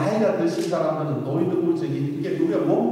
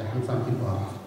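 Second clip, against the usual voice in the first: scripture intonation; 7 semitones lower, 4 dB quieter than usual. Acted. Quoting scripture in sermon. (성경 구절을 낮고 천천히 읊듯)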